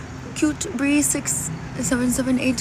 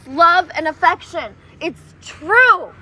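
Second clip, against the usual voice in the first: ordinary delivery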